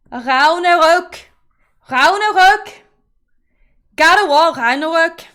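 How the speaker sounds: Ragnarök loud, swallowed, like "rau-no-rok"; mock Danish pronunciation. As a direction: mimic Danish RAGNARÖK loudly. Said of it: "And then Danish".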